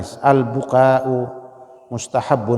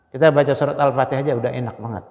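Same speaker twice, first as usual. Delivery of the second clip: ordinary delivery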